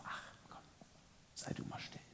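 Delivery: breathy whisper telling him to hush. Pretend. Inner voice hushing the seeker, whispered. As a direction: whisper it like a doubt in the ear.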